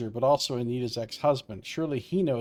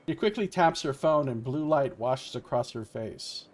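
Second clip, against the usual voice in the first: usual speaking voice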